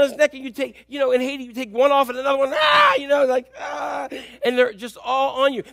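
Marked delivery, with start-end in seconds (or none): 2.5-2.9 high, loud "ah!"; clinging kid's cry. Retold or imitated. Preacher acting out Haitian kids grabbing on, excited yell. 3.6-4.1 high-pitched "ah"; mock kid's squeal; clinging kid's cry. Preacher acting out kids piling on, excited cry.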